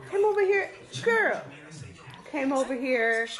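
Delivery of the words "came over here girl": high voice for the dog; high doggy talk. Real, not acted. Owner talking to the dog, high pet voice.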